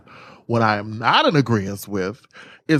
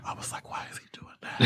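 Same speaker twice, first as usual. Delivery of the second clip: unvoiced whisper, laughing underneath; 21 dB quieter, faster than usual. Wondering about Matthew's whisper, still whispering. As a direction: keep whispering while asking why. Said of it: "he talks like this".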